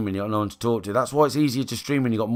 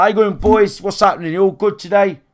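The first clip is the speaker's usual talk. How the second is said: higher, louder upbeat greeting; mock hype streamer; 7 semitones higher, 7 dB louder than usual. Imitating the upbeat streamer he should be: louder, higher hype. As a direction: pump it up like an energetic streamer. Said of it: "I should be like that".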